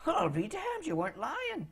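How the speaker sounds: high, soft astonishment; amazed wizard. Acted. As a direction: let it out high and hushed in disbelief. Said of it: "you guys haul it out of there and says".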